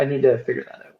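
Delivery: usual speaking voice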